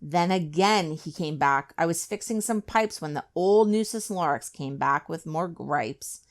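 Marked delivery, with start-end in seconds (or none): none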